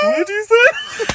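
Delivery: hammed-up silly high voice; cross-eyed goofy voice. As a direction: drawl it like a dopey cross-eyed guy. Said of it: "You went cross-eyed".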